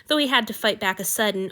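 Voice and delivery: ordinary delivery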